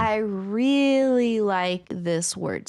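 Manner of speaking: very slow and relishing; slow, savouring. Real, not acted. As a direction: linger on every word. Savouring word.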